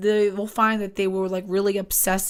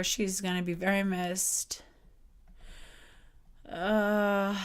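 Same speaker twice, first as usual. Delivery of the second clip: ordinary delivery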